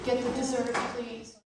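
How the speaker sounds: very soft, clipped-back request; quiet order. Acted. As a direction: hush it through tight restraint.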